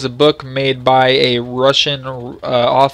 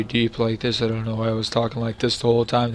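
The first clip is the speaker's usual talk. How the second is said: droning low, much softer, no life in it; mock deep monotone; 2 semitones lower, 8 dB quieter than usual. Shown as a demonstration. Reviewer mocking his old monotonous video voice. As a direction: sink into a dull monotone mumble. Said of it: "my voice in that was obviously very monotonous and very".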